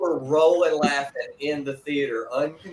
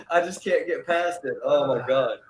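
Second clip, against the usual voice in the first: usual speaking voice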